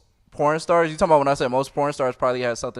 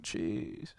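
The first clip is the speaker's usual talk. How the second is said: soft, lightly voiced old man; 5 semitones lower, 15 dB quieter than usual. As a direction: sigh it like a weary old man. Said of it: "That's my old man voice".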